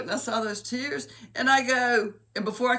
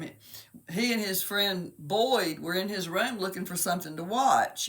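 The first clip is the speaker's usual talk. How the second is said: ordinary talk, nothing marked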